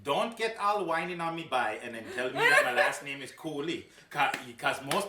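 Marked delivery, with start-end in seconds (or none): 0.0-3.9 high laughing protest. Real, not acted. Laughing protest over name.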